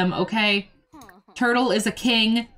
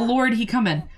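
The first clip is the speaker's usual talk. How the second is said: ordinary talk, nothing marked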